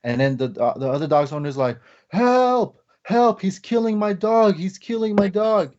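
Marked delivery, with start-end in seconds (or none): 2.1-5.7 a frantic owner yelling help, voice way up; panicked cry for help. Retold or imitated. Neighbor screams as her dog is attacked, panicked.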